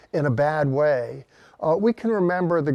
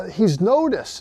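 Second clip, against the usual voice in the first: normal talking voice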